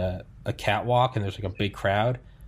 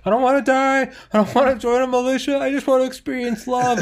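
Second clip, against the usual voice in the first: high, loud, hammed-up wail; mock screaming and crying; 14 semitones higher, 4 dB louder than usual. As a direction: plead in a pitched-up panicked cry. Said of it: "I want you screaming and crying".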